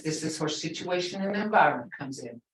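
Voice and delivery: normal talking voice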